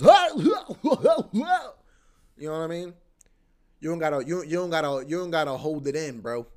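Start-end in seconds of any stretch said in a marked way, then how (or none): 0.0-2.9 a big, high shout of laughter through the words; laughing loudly. Real, not acted. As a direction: burst out laughing mid-sentence. Laughing at own joke.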